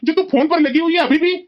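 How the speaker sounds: high, loud, urgent reproach; angry scolding. Acted. Husband scolding wife on the phone while mother lies fallen, angry. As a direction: scold her and order her to help.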